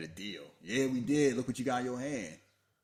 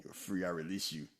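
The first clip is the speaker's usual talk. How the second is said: ordinary talk, nothing marked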